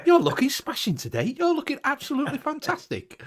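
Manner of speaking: high, mock-delighted gushing; lightly hammed-up delighted shopper; flattered shopper. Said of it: "people are going".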